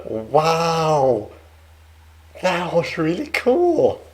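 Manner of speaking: loud, high delighted wow; thrilled by the accent; excited. Real, not acted. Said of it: "I am very fascinated".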